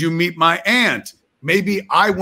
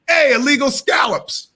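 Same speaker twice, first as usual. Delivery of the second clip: calling out; 10 semitones higher than usual. Retold or imitated. Calling out the townie.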